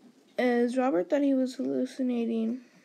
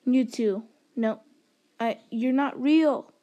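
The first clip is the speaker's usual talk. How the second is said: loud accusing protest, last words stretched; loud disbelief; 7 dB louder, slower than usual. Acted. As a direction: refuse loudly to believe it, slowing at the end. Robert confronts the ghosts in story.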